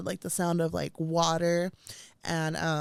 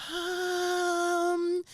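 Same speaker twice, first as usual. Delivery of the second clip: stuck um; 11 semitones higher, 4 dB quieter than usual. Retold or imitated. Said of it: "and I was just like".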